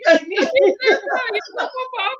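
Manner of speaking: high soft giggling; high giggle. Real, not acted. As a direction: giggle lightly.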